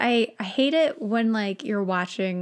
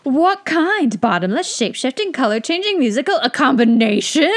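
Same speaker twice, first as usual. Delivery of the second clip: louder, higher eager listing; excited wizard; 4 semitones higher, 7 dB louder than usual. Acted. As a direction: rattle off options with glee. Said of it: "got very excited".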